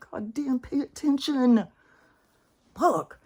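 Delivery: high, exasperated call; frustrated plea. Real, not acted. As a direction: urge people to wake up.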